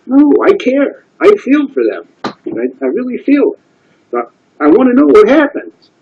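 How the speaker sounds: a rabbi insisting he truly cares; earnest rabbi pleading. Retold or imitated. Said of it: "he says".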